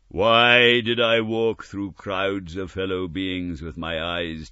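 slow building ghostly sorrow; suffering ghost. Acted. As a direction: grieve aloud as a spirit. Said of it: "the spectre said".

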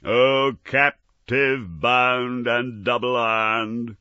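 loud, slow spectral lament; anguished ghostly cry. Acted. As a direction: cry it out like a tormented spirit. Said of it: "cried the phantom".

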